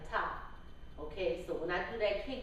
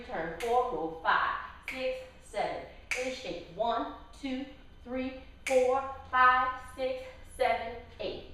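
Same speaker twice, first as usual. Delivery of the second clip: counting out the beats; 4 semitones higher than usual. Shown as a demonstration. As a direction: count the dance like an instructor. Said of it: "I'll count it out for you".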